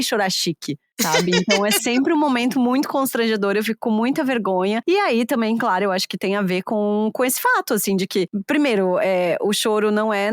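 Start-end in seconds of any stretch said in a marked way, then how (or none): none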